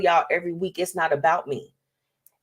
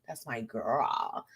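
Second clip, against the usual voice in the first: slow, soft, lower, tender murmur; tender hushed repeat; 7 semitones lower, 11 dB quieter, much slower than usual. Real, not acted. Host praising her friend Chanel, soft tender repeat. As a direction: say it softly like a proud big sister.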